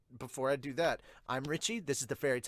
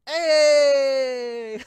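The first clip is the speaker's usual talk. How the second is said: loud, high yell of joy; cheering shout; 12 semitones higher, 15 dB louder than usual. Acted. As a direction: throw it out like a victory cry.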